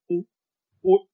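ordinary delivery

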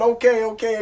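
loud, high retold hushing of a crowd; calling for order. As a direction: imitate a judge settling a noisy room. Judge quieting the retold courtroom.

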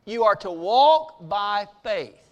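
ordinary talk

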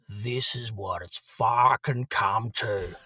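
slow, mournful deadpan; Ben's gloomy voice. Retold or imitated. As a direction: drag it out like a defeated man. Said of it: "I just hear this voice go".

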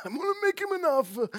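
high put-on Italian mafia don; mock godfather making an offer; mobster voice. Preacher joking to the church as a mobster.

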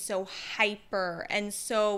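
normal talking voice

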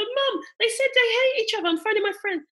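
high, loud, imitating a tearful call; mock tearful plea. Mum retells phoning her own mum about sibling fights, mock tearful plea. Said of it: "I'm in tears going".

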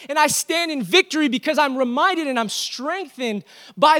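loud, high-pitched preaching to the church; rally the congregation with fire; full-force preaching. Acted.

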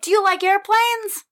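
put-on high, sweet little kid greeting; child's voice. Nephew grabbing his aunt's hand, high childlike voice. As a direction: greet your aunt like a small boy. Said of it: "he's like".

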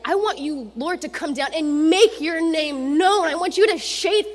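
loud, high, urgent plea to God; Isaiah crying out to God, loud and high; pleading Isaiah. Acted. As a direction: demand God shake the world, rising to a cry. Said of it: "So Isaiah's like".

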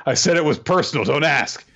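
high, sharp mock snap; snapping. Girl snapping at the boy asking her reason, mock irritated. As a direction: cut him off, a touch testy.

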